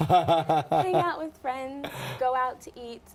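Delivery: slow, laughing through the words; laughing answer. Real, not acted. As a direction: say it still laughing.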